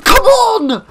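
very high, loud yell; frustrated shout. Real, not acted. Gamer fed up with bad guns, frustrated shout. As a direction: yell it out at the game, high and exasperated.

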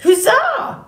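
high excited squeak; squeaky cheer. Acted. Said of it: "squeaked the beaver".

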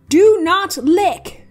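loud, high, slow, every word hit; emphatic warning. Acted. Warning viewers off touching cinnabar, slow loud emphasis. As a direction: lay down the law, slowly. Stern warning about deadly mineral.

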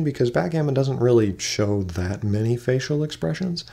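ordinary talk, nothing marked

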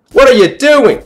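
high, loud cry of disbelief; exasperated yell. Real, not acted. Gamer challenging what happens in game, exasperated yell.

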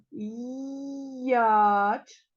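м'яч stretched sound by sound, long loud vowel; very slow м-йааа-ч for learners. Shown as a demonstration. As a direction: draw out every sound of М'яч. Teacher sounds out the word.

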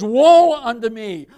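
loud, slow lament; crying out. Acted. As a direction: wail it slowly, full voice. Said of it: "He cried out".

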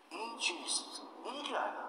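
high, soft, amazed asking; astonished question. Retold or imitated. Stunned Maulana holding his head before the dry books, astonished. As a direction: ask in hushed disbelief, pitched high.

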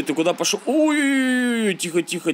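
ordinary delivery

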